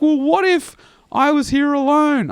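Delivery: high-pitched voice of a worried woman; imitated woman's voice. Woman worried about being here alone, high imitated voice.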